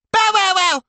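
loud, very high squeaky play-bark; barking child. A narrator's reading. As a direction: bark like a little boy playing dog. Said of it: "barks Remington".

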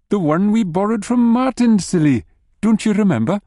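high, teasing wife voice; lightly voiced chiding wife. A narrator's reading.

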